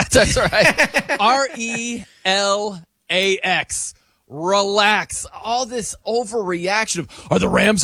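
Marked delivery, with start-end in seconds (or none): 1.2-5.3 spelled out slowly, letter by letter; Aaron Rodgers quote. Retold or imitated. Host echoing the clip to calm overreaction, slow spelling. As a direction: draw out each letter, then the word. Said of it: "the old, uh, Aaron Rodgers thing".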